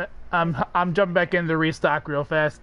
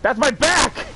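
anime yell; 7 semitones higher, 3 dB louder, slower than usual. Acted. Riffing on the Vegeta scream.

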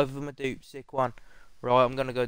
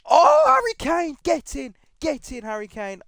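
a much higher, much louder scream of joy; ecstatic shout; 13 semitones higher, 8 dB louder than usual. Real, not acted. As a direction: yell out in pure delight. Packs a wanted star player.

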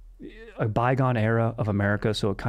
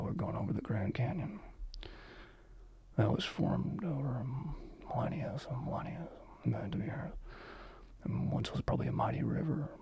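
soft breathy captain talking over the intercom; whispery pilot announcement; 13 dB quieter than usual. Acted. Mock pilot telling passengers about the Grand Canyon, hushed. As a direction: hush it like a pilot narrating the scenery. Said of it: "right now I could be a pilot".

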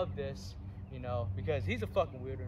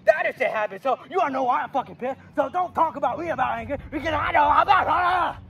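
louder, higher furious ranting; angry rant; 8 semitones higher, 9 dB louder than usual. Acted. Coach raging at the kid about his cousin.

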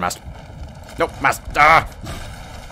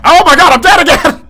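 much higher, louder exasperated cry; exasperated shout; 11 dB louder than usual. Real, not acted. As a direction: shout it out in disbelief. Dies yet again.

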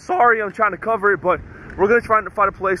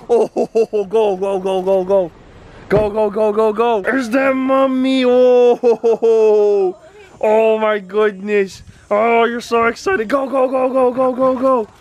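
high, eager cheering at the dogs; excited cheering; 7 semitones higher, slower than usual. Real, not acted.

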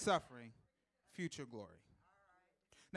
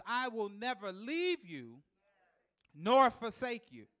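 slow, every word weighed, nor forsake louder; slow emphatic quote; 5 semitones higher, 5 dB louder, slower than usual. Acted. Preacher quoting the Lord, slow and emphatic. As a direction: declare it slowly. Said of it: "The Lord says".

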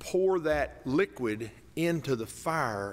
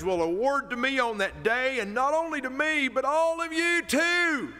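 preaching; 6 semitones higher, slower than usual. Acted. Preaches scripture.

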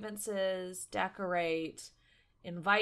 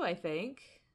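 ordinary talk, nothing marked

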